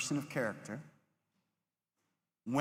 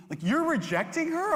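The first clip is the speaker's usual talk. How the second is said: mock accusing friend; 13 semitones higher, 5 dB louder than usual. Kids accuse him in retold story.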